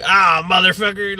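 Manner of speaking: lightly put-on hillbilly accent; mock hillbilly grumble. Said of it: "a racist hillbilly".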